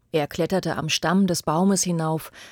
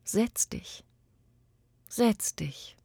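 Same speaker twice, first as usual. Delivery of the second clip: soft and higher, barely voiced; tender whisper; 4 semitones higher, 5 dB quieter, slower than usual. Tree offers its stump to the old boy, tender whisper. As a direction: coax him down gently, like to a child. Tree gently invites him to sit.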